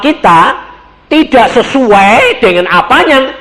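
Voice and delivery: loud, high, emphatic sermon delivery; forceful preaching. Real, not acted. Preacher warns listeners against limiting faith, loud forceful preaching. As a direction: drive the warning home to the congregation.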